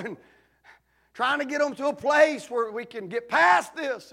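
loud, high preaching shout; shouting preacher climax. Real, not acted. Preacher telling the church of healing, at full shout. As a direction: build to the top of the sermon.

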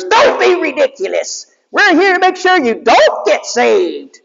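high mocking cartoon robot; high squeaky robot henchman; Scratch's voice. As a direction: sneer it in a high squeaky villain voice. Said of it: "And Scratch goes".

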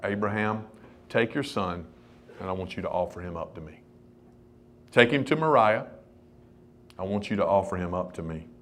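low-pitched, hushed voice of God; quiet command. Acted. Voices God quietly instructing Abraham.

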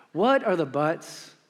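usual speaking voice

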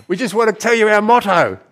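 high, loud cheery call. Retold or imitated. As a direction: shout it down brightly. Instructors calling down cliff.

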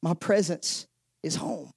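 a quiet, unhurried divine reply; God speaking. Retold or imitated. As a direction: hush it down slow like God answering. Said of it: "And he said".

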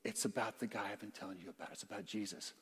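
low, very soft reveal; hushed, low-pitched disclosure; acted hushed reply. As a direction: lean in and confide it quietly. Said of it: "what did Philip say?".

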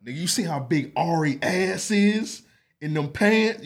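rowdy shout. Retold or imitated. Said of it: "smoking a cigar like".

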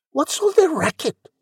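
very high-pitched question; crying out. A narrator's reading. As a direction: call it out in a high girlish voice. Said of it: "Cindy cried".

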